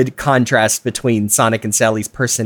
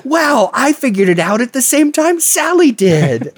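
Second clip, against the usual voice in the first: put-on high, wide-eyed kid voice; excited kid voice; 12 semitones higher than usual. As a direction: squeal it like a thrilled child. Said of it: "I was like".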